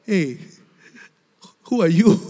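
a mock taunt that lifts at the end; mild sarcastic rebuke in God's voice; sarcastic telling off. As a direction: tease him like God putting him in his place. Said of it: "even sarcastically telling him off".

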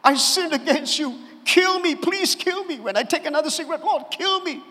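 high, pleading cry to God; mock desperate prayer. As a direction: beg God to strike you down, half-joking. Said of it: "and I would say".

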